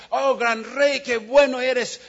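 butter up the king, hammed up; loud flattery. Nasradín flatters the king again, high and loud. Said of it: "empieza a decir".

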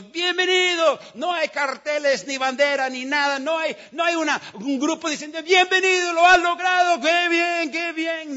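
loud, high hammed-up cheering; preacher loudly mocking a summit welcome. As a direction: shout the welcome nobody gave. Mock welcome fanfare at summit.

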